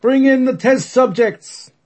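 slow, loud German shout. Acted. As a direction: bellow the order in German accent. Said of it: "shouting out".

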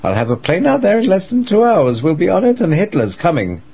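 frantic shouting. Acted. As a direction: bark the orders in a panic. Nervous Dietrich barks orders by phone.

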